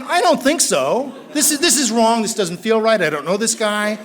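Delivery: loud, high, frantic inner monologue; panicked protest. Retold or imitated. Newcomer inwardly panicking at a stranger sponsor, loud and frantic. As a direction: blurt it in rising alarm.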